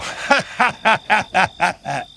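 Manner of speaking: a brief falling laugh; quick laugh, trailing down; short laugh. Acted. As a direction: let out a short laugh that drops away. Laugh after monster talk.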